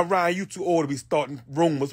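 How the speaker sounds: ordinary talk, nothing marked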